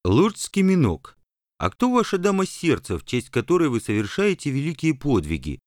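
высокий насмешливый голос рыцаря; спросить с кривой ухмылкой; ехидный рыцарь. Acted. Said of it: "С кривой улыбкой полюбопытствовал один". (ехидный вопрос рыцаря)

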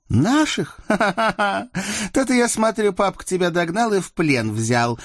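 high-pitched mocking laugh and jibe; scornful girl, high and gleeful; biting sarcasm. A narrator's reading. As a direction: laugh at him, then needle him. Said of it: "съязвила Сотникова-старшая".

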